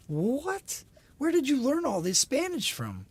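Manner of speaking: high-pitched, drawn-out disbelief; astonished. Acted. Dad hearing daughter speak Spanish, astonished. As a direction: rise sharply on the what, amazed.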